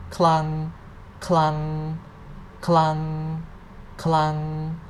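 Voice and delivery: Clung held on an even, level pitch; level-tone drill. Shown as a demonstration. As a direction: say each clung long with no rise or fall. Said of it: "Let's say that again".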